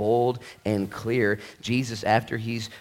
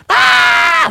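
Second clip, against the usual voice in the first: loud, high mock scream of fright; 18 dB louder than usual. Pastor acting the terrified disciples, a shrill scream. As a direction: shriek like startled disciples.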